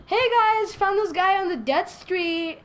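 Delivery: high, drawn-out vlogger parody; mock selfie greeting. Player posing beside a body, slow high mock vlogger voice. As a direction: stretch it out like posting for followers.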